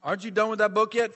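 badly faked Greek accent that comes out Italian. Greek cafe owner teases his neighbour, mock Italian accent. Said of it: "This is an Italian accent".

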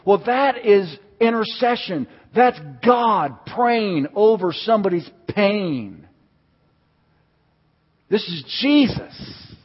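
high, loud, stretched-out proclamation; impassioned preaching. Real, not acted. Preacher telling church this is God interceding, fervent proclamation. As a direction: proclaim each phrase slowly with full fervor.

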